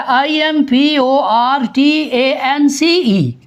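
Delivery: "आईएमपीओआरटीएएनसीई" spelled out letter by letter, slowly; spelling slowly. Shown as a demonstration. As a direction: dictate the letters one by one. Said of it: "dictate कराते हुए एक word बोला जाता है". ("आईएमपीओआरटीएएनसीई" अक्षर-अक्षर धीरे बोलें)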